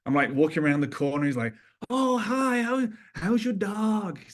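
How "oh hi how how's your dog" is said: high, slow, sweet American accent imitation; imitating an American accent. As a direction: mimic a friend's cheery American accent greeting. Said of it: "He's got his great, beautiful American accent".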